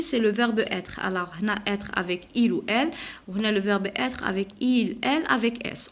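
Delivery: normal talking voice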